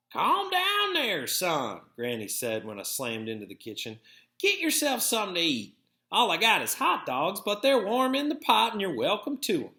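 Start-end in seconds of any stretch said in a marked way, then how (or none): 0.1-1.8 put-on much higher, slow old-woman voice; Granny. A narrator's reading. Grandmother settles the boy as he slams in, much higher voice. 4.4-9.7 put-on higher old-woman voice, easy offer; lightly voiced grandmother offering food; Granny. A narrator's reading. Grandmother offers hot dogs to the hungry boy, higher voice.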